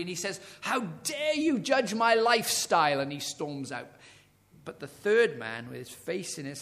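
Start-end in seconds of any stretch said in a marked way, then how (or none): 0.6-3.0 louder, higher indignant demand; angry retort. Retold or imitated. Angry smoker to the doctor, louder indignant outburst. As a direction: throw the accusation back. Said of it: "The second man also is angry".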